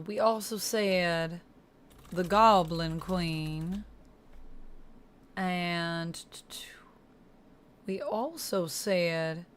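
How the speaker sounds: slow, drawn-out Southern drawl; Southern accent. Pretend. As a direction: take your time in a put-on Southern accent. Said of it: "do a bit of a Southern accent".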